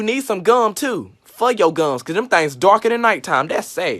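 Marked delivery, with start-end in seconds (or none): none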